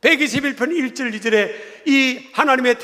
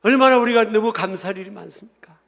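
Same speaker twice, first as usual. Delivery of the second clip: normal talking voice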